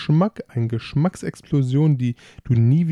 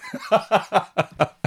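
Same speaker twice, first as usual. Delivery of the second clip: falling chuckle; laughing; 8 semitones higher than usual. Real, not acted. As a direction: break into a laugh. Laugh after tasting.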